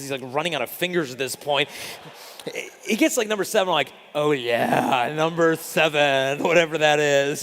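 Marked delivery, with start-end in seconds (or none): 4.1-7.4 slow, drawn-out fake certainty; mock confident bluff. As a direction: play it cool like you know the pitch. Bluffing the catcher's pitch signs.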